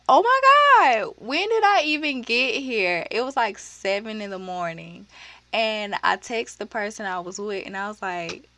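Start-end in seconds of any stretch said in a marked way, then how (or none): 0.1-3.1 shocked. Retold or imitated. Waking up confused at Chevron.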